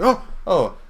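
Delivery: high, loud startled yelps with a nervous laugh; jump, then laugh it off into a greeting. Acted. Eli caught looking inside the door, startled. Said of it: "You startled me".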